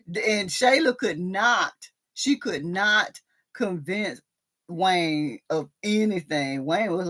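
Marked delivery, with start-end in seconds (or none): none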